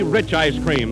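ordinary talk

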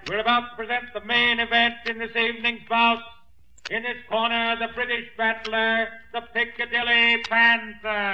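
loud, slow ring introduction; ring announcer. Acted. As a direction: announce the fighter to the arena. Ring announcer introduces main event.

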